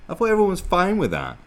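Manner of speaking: loud, high-pitched protest; exasperated. Real, not acted. Host arguing back at the woman, exasperated.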